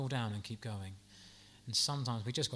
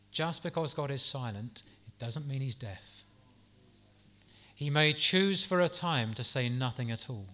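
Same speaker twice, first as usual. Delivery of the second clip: ordinary delivery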